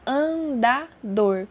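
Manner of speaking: an-da-dor, slowly split into three beats; syllable by syllable. Shown as a demonstration. Teacher counting syllables for pupils, "andador" slowly split. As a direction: break "andador" into separate, drawn-out syllables. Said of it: "eu tenho três sílabas".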